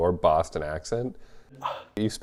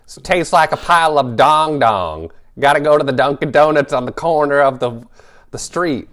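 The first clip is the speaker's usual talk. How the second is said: attempted Boston accent; 6 semitones higher, 7 dB louder than usual. Shown as a demonstration. Co-host improvising about Dunkin' Donuts in a botched, hammed-up Boston accent. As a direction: ham up a bad Boston accent like an old Bronx woman. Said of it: "try my Boston accent".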